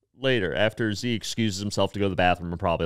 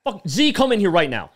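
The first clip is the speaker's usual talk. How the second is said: louder, higher barked summons; angry boss; 8 semitones higher, 5 dB louder than usual. Retold or imitated. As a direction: call him in like a furious boss. Boss summons worker angrily.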